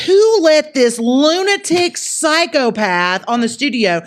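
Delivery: high, loud, drawn-out outrage; appalled disbelief. Retold or imitated. Voices host's appalled look.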